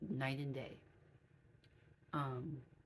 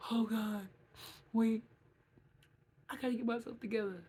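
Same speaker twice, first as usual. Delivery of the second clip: mock tearful coworker; 6 semitones higher, 5 dB louder than usual. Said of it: "They be like".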